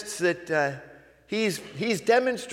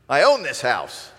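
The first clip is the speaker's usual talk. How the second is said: defensive retort; 7 dB louder than usual. Retold or imitated. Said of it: "your response might sound like".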